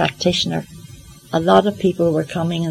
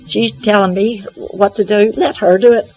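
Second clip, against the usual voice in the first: louder, mock-indignant protest; indignant retort; 4 semitones higher, 6 dB louder than usual. Arguing back at the wee voice, indignant. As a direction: push back hard, as if refusing the job.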